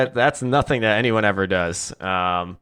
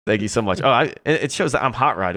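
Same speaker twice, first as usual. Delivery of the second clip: normal talking voice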